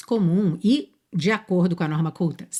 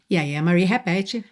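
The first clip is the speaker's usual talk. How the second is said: usual speaking voice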